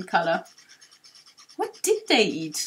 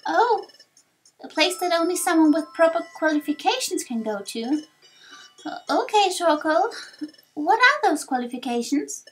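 higher, eager youngster voice; lightly voiced young hero, curious; 7 semitones higher, 5 dB louder than usual. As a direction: ask like an inquisitive young adventurer. Young hero questions the sage.

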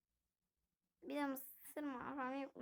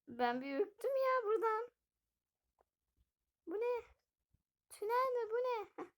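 higher, startled and uneasy; jumpy scared questions; 8 semitones higher, 4 dB louder than usual. Pretend. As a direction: sound spooked by the tunnel. Spooked in dark place.

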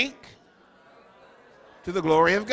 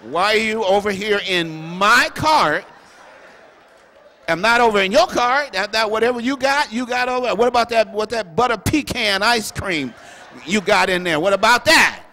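loud, indignant, higher-pitched comeback; comic angry retort; 5 semitones higher, 9 dB louder than usual. Retold or imitated. Bishop turning the tables on the shopper, loud and indignant. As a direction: fire back at the nosy shopper, louder and louder.

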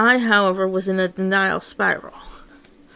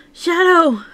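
loud, high cry of a name; urgent call; 7 semitones higher, 6 dB louder than usual. Acted. Manic calling Shadow for help, loud and high. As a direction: yell the name to warn.

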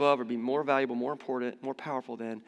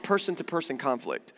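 ordinary delivery

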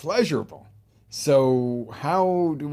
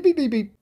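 high, loud beeps like a bleep tone; mock censor bleep; 9 dB louder than usual. Coach bleeping out a rude word, high mock beeps.

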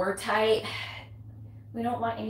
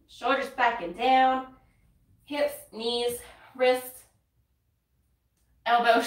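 ordinary delivery

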